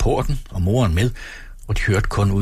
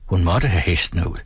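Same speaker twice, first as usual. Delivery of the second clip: usual speaking voice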